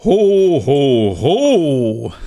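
slow, loud, booming ho ho ho; proper Santa ho ho ho. Shown as a demonstration. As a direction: stretch each ho out like Santa. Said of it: "Du betonst es nicht richtig".

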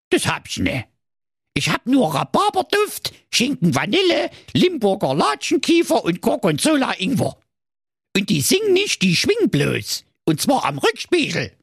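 grumpy dwarf. Acted. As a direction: drag it out sulkily, like a surly dwarf. Dwarf voiced in fairy tale.

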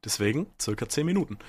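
ordinary talk, nothing marked

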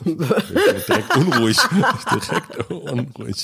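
slow words through giggles; laughing while teasing. Real, not acted.

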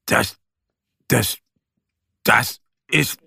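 halting, repeated das; stammering. A narrator's reading. Nervous bear stammering at the strange animal. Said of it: "stammelt Mo".

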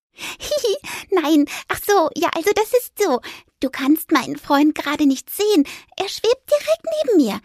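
a flustered mouse explaining her invisible friend; embarrassed mouse. Acted. Fipper blushes introducing the ghost, high giggly mouse voice.

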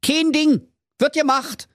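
high, breezy Berlin promise; cheerful. Acted. Schnitzelschorsch cheerfully agrees to the villagers, high breezy voice. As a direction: toss it off happily. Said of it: "rief ... fröhlich".

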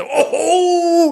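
high, loud mock crowd ooh; audience whoop. Comic retells the crowd's reaction, high loud imitated whoop.

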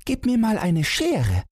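put-on high-pitched little boy's voice; five-year-old son. A narrator's reading. Son asks father for scissors, high child's voice. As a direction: pitch it way up like a small child asking.

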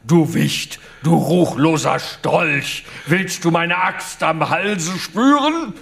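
mock-furious knight; thundering threat. A narrator's reading. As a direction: threaten him like a wrathful knight. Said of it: "donnerte Romuald".

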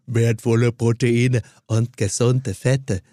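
slow low Ralf Möller voice. Retold or imitated. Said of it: "als Ralf Möller machst".

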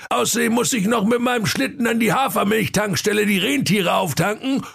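put-on very high, shouty old Santa voice; worked-up Santa. Santa ranting orders at his lazy helper, high shouting.

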